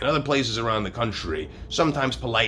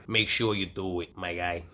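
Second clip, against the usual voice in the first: ordinary delivery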